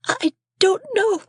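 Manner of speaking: high, tearful whimper; whimpering boy. A narrator's reading. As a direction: Pip whimpers at his scolding sister. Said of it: "I whimpered".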